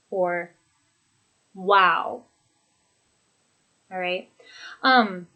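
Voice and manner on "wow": "Wow" with a falling tone; downward, impressed "Wow"; falling intonation. Shown as a demonstration. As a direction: let "Wow" come down at the end. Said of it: "And then with falling intonation".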